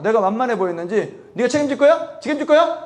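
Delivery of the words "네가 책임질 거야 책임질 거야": high-pitched, pushy retold challenge; rude confrontation. Lifeguard challenges preacher over the students' safety, rude and high. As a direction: imitate someone picking a fight in blunt speech. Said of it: "나중에 반말 하더라고".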